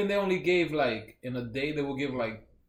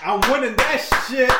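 loud, higher boast; boastful shout; 9 semitones higher, 9 dB louder than usual. Real, not acted. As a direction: crow about winning. Boasting about the trophy.

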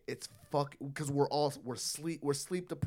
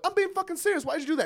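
high-pitched mock scolding; trying to sound serious; 3 dB louder, faster than usual. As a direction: plead to be taken seriously by a laughing kid. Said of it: "listen to my voice".

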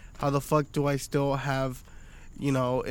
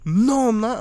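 put-on indignant kid voice; 7 semitones higher, 7 dB louder than usual.

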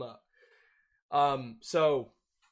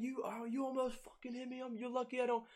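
mock furious rant; 12 semitones higher than usual. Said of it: "He's like".

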